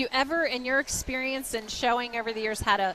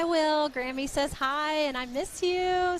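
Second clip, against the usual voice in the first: higher, slow and tender, to a small grandchild; tender grandma greeting; 6 semitones higher, slower than usual. Real, not acted. Greeting grandson watching at home.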